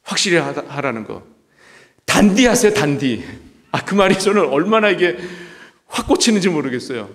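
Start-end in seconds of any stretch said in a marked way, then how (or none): 2.0-3.2 a hint of Gyeongsang dialect on 단디하세요; 경상도 사투리로 단디하세요; Gyeongsang dialect word. Shown as a demonstration. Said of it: "그 경상도 사투리로 뭐라고 그럽니까?".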